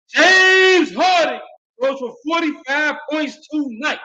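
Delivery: high, loud, stretched-out celebration yell; hype shout. Real, not acted. Fan yelling the player's big night at his viewers. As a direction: shout it like his team just won.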